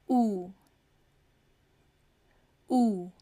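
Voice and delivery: ऊ held long with rounded lips; oo sound. Shown as a demonstration. Teacher modelling ऊ for her class. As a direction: stretch ऊ into a long oo. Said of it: "ऊ का sound".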